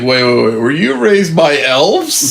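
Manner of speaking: slow, high incredulous disbelief; stunned local gawking at oddity; surprised. Pretend. Acting surprised NPC reaction.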